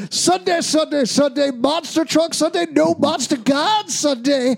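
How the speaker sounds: high booming Sunday Sunday Sunday promo; monster truck ad. Acted. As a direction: hype it like an arena announcer. Church intro turned monster truck promo.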